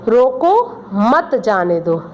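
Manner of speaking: रोको rising, a break, then मत जाने दो falling slowly; pause after रोको. Shown as a demonstration. Teacher reads the comma example to students, pause after रोको. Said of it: "रोको के बाद कॉमा लगा है".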